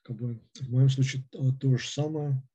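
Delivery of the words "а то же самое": ordinary talk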